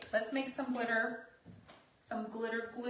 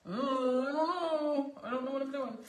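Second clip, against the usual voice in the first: unsure voice; 6 semitones higher, 6 dB louder than usual. Pretend. Voicing the painting's doubt.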